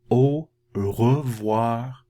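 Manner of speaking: "Au revoir" stretched out at half speed; teacher modelling goodbye, slow and clear; slow French demo. Shown as a demonstration. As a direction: draw out "Au revoir" slowly for the class. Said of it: "a couple different things you can use".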